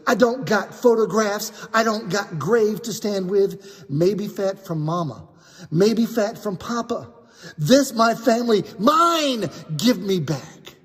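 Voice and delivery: pleading broken English. Acted. Daniel begs the men to give back his soap, pleading. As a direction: beg to keep what's left of his family. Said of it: "he's new to English, so his English is a little broken".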